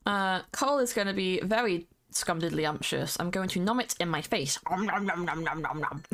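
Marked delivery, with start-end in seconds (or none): 4.7-6.0 mock munching. Pretending to eat cocoa.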